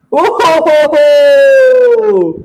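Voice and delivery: high, loud, slow drawn-out delight; delighted surprise. Real, not acted. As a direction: marvel at the gift. Opening child's gift. (आश्चर्याने Anand)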